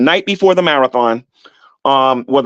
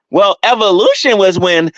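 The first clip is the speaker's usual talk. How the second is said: slow, higher mock-ignorant voice; clueless newbie; 5 semitones higher, slower than usual. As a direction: sound like a clueless kid explaining evolution. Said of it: "That's how you sound".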